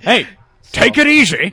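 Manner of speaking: high-pitched, slurred Scottish-actor impression; Sean Connery. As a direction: put on a Scottish slushy "s". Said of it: "I'm Sean Connery".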